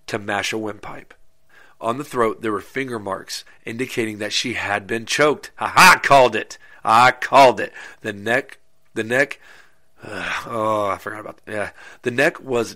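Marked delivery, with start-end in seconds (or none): 5.6-7.7 loud, gleeful "called it"; triumphant gloat. Real, not acted. Host gloats to listeners that his guess was right, loud. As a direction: crow loudly that you were right.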